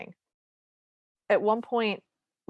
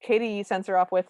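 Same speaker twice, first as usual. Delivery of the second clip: ordinary delivery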